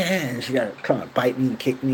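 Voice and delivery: ordinary talk, nothing marked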